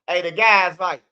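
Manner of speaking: ordinary delivery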